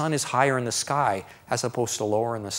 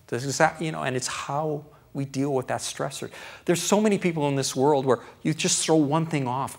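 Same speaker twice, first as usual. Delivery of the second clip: usual speaking voice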